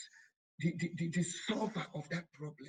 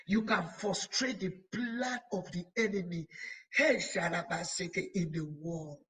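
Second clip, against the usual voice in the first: usual speaking voice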